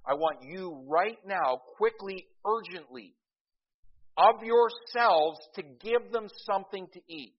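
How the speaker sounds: urgent voice of Jesus commanding. Retold or imitated. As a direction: press them to act right now. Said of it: "which means you yourselves".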